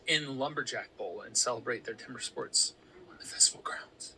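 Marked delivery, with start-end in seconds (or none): 3.1-4.1 whisper. Real, not acted. Reading trails to whisper.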